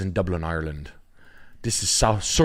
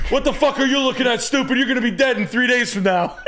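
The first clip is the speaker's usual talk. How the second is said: much higher, louder mock thug yell; menacing drive-by tough guy; 4 dB louder than usual. Pretend driver threatening a realtor, hammed-up yell. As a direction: bark it from a car window in jest. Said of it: "I'm driving by on".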